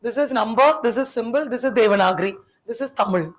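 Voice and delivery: normal talking voice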